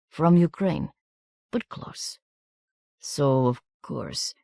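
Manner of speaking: put-on halting accented English, slowing and softening; hesitant Eastern European accent with drawn-out final words; gentle Romanian accent, piece by piece; soft foreign accent. A narrator's reading. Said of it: "in his soft accent".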